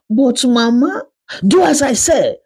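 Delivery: pleading character voice. Acted. Daughter-in-law protests, mother cuts in.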